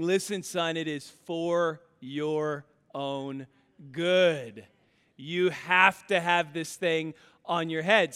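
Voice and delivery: it is for your own good drawn out slow; slow stern advice. Retold or imitated. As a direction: lay down the rule slowly to your son. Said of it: "And I'm saying".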